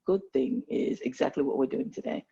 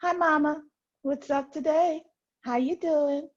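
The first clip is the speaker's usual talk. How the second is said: high, playful Southern greeting; mock Southern hello; Southern accent; 10 semitones higher than usual. Shown as a demonstration. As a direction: put on a sing-song Southern US accent, pitched way up. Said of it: "When I start speaking my Southern accent".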